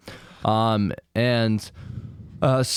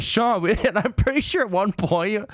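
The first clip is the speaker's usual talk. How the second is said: laughing; 8 semitones higher than usual. Real, not acted. Laughing while retelling Mark.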